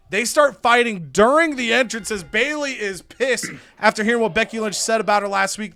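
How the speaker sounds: ordinary delivery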